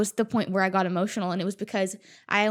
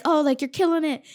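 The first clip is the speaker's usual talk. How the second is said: higher, louder mock cheer; cheering from behind; 8 semitones higher, 6 dB louder than usual. Runner cheering someone ahead, high and loud. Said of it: "you're like".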